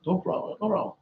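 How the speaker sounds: ordinary delivery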